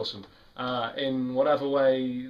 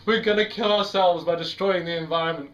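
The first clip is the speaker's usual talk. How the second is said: louder mock doom warning; alarmiste parodique; 3 semitones higher, 4 dB louder than usual. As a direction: act out an alarmist slogan. Acts out an alarmist message.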